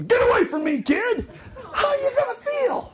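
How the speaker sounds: mock shout, rising high; hammed-up cranky costume character yelling; grumpy Goofy. Goofy having a bad day snaps at a kid, mock shout.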